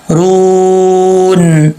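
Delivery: رون with a full-mouth ر and a stretched وو; heavy ra, long waw. Shown as a demonstration. Teacher modelling heavy ra and madd. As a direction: hold the long u of رون for one alif. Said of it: "پر پڑھیں گے را کو".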